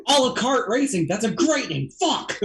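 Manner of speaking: high, mock-exasperated praise; Jesse. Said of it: "ideas, he's like".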